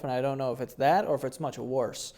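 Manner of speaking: ordinary talk, nothing marked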